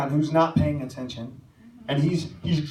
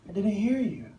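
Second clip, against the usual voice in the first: put-on higher, small-child voice; innocent voice; 7 semitones higher than usual. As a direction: imitate an innocent little boy. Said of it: "with an innocent voice".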